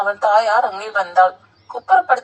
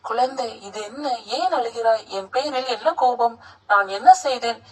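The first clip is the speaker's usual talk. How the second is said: ordinary delivery, nothing marked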